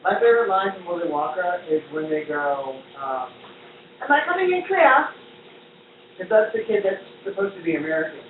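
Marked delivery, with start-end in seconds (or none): 4.0-5.0 high, loud imitated British kid accent. Recalling a favorite Willy Wonka line, high British accent. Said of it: "very thick British accent".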